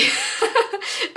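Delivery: high, loud teary laugh; emotional laugh. Real, not acted. Laughs at her own emotion. (rire ému, aigu et fort)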